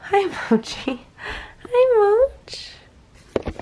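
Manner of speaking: squeaky high pet voice. Real, not acted. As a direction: coo it to the dog. Greeting the dog.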